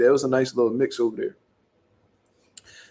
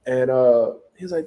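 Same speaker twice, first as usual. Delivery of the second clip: usual speaking voice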